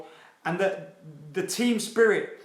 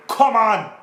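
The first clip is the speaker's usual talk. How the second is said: excited shout; 5 semitones higher, 9 dB louder than usual. Real, not acted. Hyped fan cheering for United.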